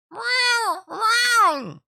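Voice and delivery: very high, loud meow; cat meowing. A narrator's reading. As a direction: yowl like an impatient cat at the door. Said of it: "cried Simpkin".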